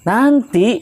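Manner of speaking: mother snapping "Nanti" up high; mock-angry mother brushing off her child; imitated angry snap. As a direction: cut the whining child off sharply.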